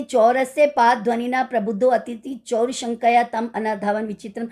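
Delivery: ordinary delivery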